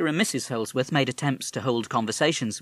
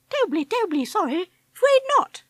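very high, plummy chinless-wonder voice; high affected toff parrot; affected posh parrot; 6 dB louder than usual. Acted. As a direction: play a silly upper-class twit bird. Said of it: "the affected way he spoke, like a chinless wonder".

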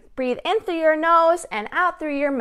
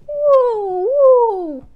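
high, sliding "woo" noises; long playful high woos; swooping woo; 9 semitones higher, 4 dB louder than usual. Real, not acted. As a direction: swoop down like riding a slide. Sound effects for wiggling torso.

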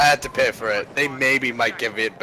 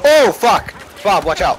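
raging gamer; 5 semitones higher, 7 dB louder than usual. Real, not acted. Teammate killed, yelling in game.

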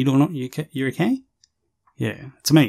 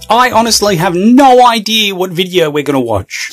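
loud, higher-pitched announcement; yelling; 4 semitones higher, 4 dB louder than usual. Acted. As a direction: yell it straight into the mic. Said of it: "Don't fucking yell at me".

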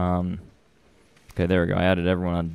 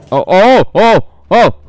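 very high, loud yelps; real panic; 9 dB louder than usual. Real, not acted. Streamer scared by the game, panicked shrieks. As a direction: yelp in short, panicked bursts.

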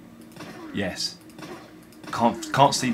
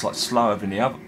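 ordinary talk, nothing marked

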